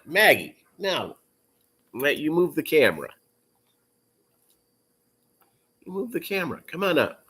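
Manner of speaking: high coaxing pet voice; talking to a dog. Real, not acted. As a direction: sweet-talk the dog up. Coaxing the dog onto camera.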